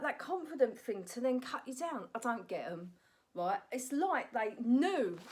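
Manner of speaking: normal talking voice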